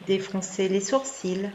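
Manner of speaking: normal talking voice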